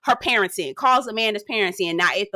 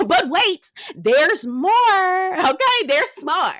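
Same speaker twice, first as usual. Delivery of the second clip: higher, hyped "there's more"; infomercial hype; 8 semitones higher, much slower than usual. Real, not acted. Teasing viewers, hyped infomercial voice. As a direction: sell it like a TV ad.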